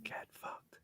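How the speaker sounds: barely audible whisper; whispered prompt. Acted. Host feeding Bobby the answer, whispering. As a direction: whisper it like feeding someone their line.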